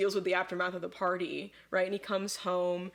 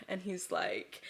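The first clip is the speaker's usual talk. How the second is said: usual speaking voice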